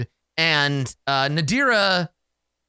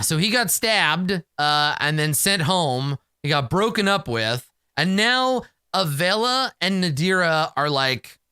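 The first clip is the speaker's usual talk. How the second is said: ordinary delivery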